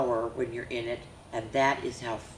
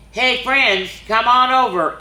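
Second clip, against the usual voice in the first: loud, higher friendly call; 9 semitones higher, 9 dB louder than usual. Acted. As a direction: call the friends over from afar. Reindeer calling out to friends.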